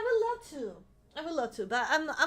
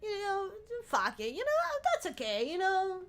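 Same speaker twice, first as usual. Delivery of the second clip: mock easygoing; 8 semitones higher than usual. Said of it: "I'll be like".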